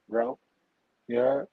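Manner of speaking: ordinary talk, nothing marked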